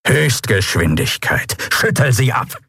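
villainous master giving orders; commanding captain. A narrator's reading. Cat captain orders crew to flee.

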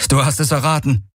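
high-pitched reply at moderate volume; calling back. A narrator's reading. Man confirms the order to the rider, calling back.

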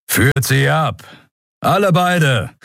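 commanding call. Acted. Lieutenant orders subordinates about.

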